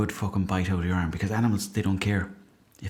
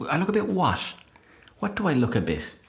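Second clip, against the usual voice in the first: much higher, challenging retort; mock indignation; 8 semitones higher than usual. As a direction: demand an answer.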